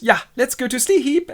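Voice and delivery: high, eager lover's reply; keen Lysander. Acted. Lysander agreeing to camp with Hermia, eager. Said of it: "And he goes".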